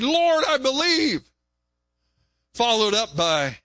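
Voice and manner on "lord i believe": slow, high plea of belief; voiced prayer. Believer praying to God, a slow high plea. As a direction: lift the words up slowly to God. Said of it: "where you just said".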